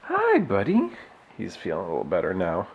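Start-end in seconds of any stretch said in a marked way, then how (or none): 0.1-0.9 pet voice. Real, not acted. Greeting the cat.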